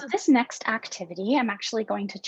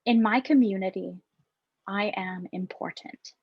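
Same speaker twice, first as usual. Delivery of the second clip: calm affirmations. Acted. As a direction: offer each line for the group to echo. Reads affirmations to repeat.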